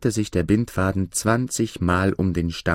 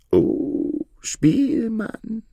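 high, drawn-out fox; much slower than usual. A narrator's reading. Fox answering the minstrel, high storybook voice. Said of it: "antwortete der Fuchs".

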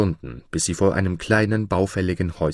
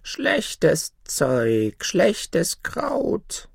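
put-on muttering old hag; 5 semitones higher, much slower than usual. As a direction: mutter it like a sour old witch. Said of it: "murmelte sie".

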